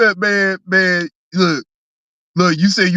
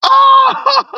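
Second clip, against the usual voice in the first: high, loud burst of delight; real delighted whoop; excited shout; 6 dB louder than usual. Real, not acted. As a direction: yelp it out. Reacting to a funny chat.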